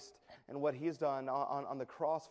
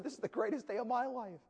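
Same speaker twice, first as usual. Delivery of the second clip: higher, lifted with joy; overjoyed echo; 8 semitones higher than usual. Acted. Soldier echoing the businessman after his conversion, overjoyed. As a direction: echo it back beaming with delight.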